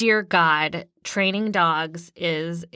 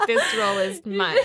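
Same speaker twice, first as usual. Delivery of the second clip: laughing; 11 semitones higher, faster than usual. Real, not acted. Laughing claim of the role.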